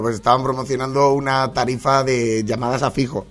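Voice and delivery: normal talking voice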